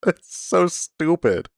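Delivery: slow, high, laugh-stretched words; laughing drawl. Real, not acted. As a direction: draw it out through laughter. Still laughing at the name.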